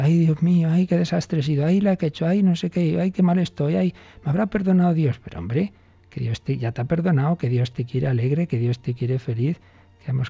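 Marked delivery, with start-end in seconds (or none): 0.0-5.2 higher, whiny self-pitying sighs; mock lament. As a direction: parody a fretful sinner moaning. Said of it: "Pues muchas veces nos pasa".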